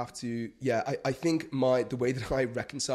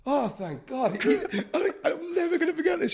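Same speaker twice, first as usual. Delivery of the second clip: high, loud retelling through laughter; laughing relief; 7 dB louder than usual. Retold or imitated. Reporter telling how the protester softened, laughing hard. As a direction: let the laugh push the voice right up.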